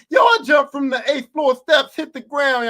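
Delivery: high, shouted rap line. Retold or imitated. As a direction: belt the lyric like an excited fan. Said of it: "[name] in that song Run".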